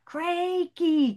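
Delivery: high, loud call across the pond. A narrator's reading. Miriam calling Crakey in the mill pond. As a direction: call her name far over the water. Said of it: "called Miriam".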